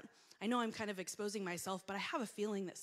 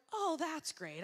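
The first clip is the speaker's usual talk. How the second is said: higher-pitched dismayed sarcasm; mortified sarcasm; 8 semitones higher, 4 dB louder, slower than usual. Retold or imitated. Woman just told of her gaffe, mortified sarcasm. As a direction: react with rueful, acted dismay. Mortified reaction after learning of divorce.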